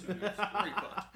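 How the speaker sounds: tipsy, laughing slow. Real, not acted. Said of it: "I'm tipsy".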